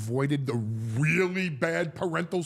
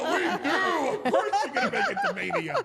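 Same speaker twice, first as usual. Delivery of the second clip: much higher, laughing mock reassurance. Answering the kid's teasing, in a high laughing mock gush. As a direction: gush it through laughter, hamming it up. Hosts reassuring kid, acted quote.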